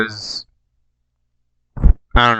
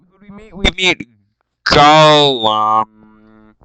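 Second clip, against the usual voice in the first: ordinary delivery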